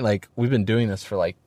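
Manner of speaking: ordinary talk, nothing marked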